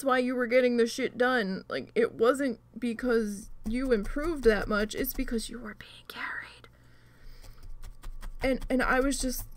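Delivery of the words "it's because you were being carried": whispered, soft jab; catty whisper. Real, not acted. Gamer whispering a dig at a bad teammate, softly. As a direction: hiss it as a snide aside.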